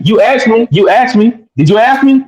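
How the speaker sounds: high-pitched, repeated challenge; insistent challenge. Real, not acted. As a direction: push back, pitch climbing on each repeat. Heated back-and-forth on the panel.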